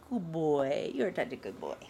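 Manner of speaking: talking to a dog. Real, not acted. Low praise to puppy.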